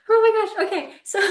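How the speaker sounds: thrilled outburst before a romantic story; excited squeal. Real, not acted. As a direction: gush with sudden excitement. Excited memory of kiss story.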